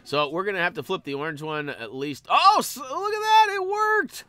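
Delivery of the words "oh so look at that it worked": high, loud burst of amazement; surprised delight. Real, not acted. Player seeing the puzzle unexpectedly work, surprised delight. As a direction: exclaim in sudden high-pitched triumph.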